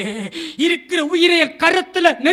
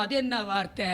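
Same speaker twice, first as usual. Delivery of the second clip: ordinary delivery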